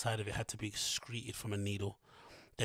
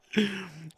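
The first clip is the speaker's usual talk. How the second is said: hearty laugh; 10 semitones higher, 6 dB louder than usual. Real, not acted. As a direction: burst of laughter. Laugh after denial.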